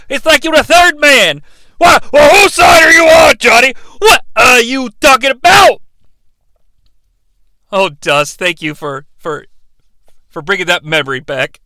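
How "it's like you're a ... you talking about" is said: loud, higher wrestling-promo voice, shouting; Dusty Rhodes impression. Co-host as Dusty Rhodes cutting a promo on the recap, loud and high. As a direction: hype it like an old wrestling legend cutting a promo. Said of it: "[name]'s Dusty Rhodes".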